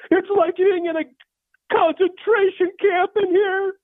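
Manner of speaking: high-pitched, drawn-out wail; complaining mom. Acted. Mom complaining about the heat from another room, high wail. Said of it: "my mom says from the other room".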